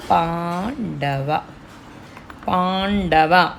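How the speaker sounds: పాండవ, పాండవ with the ం as a retroflex ణ, very slow; retroflex n in pandava. Shown as a demonstration. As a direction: drag out పాండవ with a clear ణ before డ. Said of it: "అణ రావాలి ఇక్కడ". (పాండవ లో ణ స్పష్టంగా, నెమ్మదిగా పలకండి)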